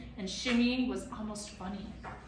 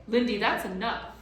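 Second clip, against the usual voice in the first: louder sharp warning; sharp reprimand; 2 semitones higher, 7 dB louder than usual. A narrator's reading. Professor stopping Lindy, sharp. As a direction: cut her off sharply. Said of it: "said the professor sharply".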